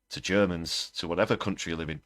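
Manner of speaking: ordinary delivery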